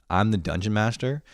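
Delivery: ordinary talk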